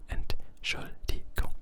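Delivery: "Entschuldigung" slowly broken into its parts; slow teaching pronunciation of "Entschuldigung"; syllable by syllable. Shown as a demonstration. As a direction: draw out "Entschuldigung" for a learner, pausing between syllables. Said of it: "Let's find a way to memorize".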